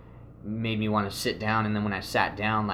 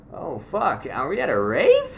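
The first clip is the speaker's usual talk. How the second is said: higher and louder, amazed; surprised rave realization; 7 semitones higher, 5 dB louder than usual. Retold or imitated. Discovering with his friends that they arrived at a rave, surprised. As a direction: shout it like you just realized.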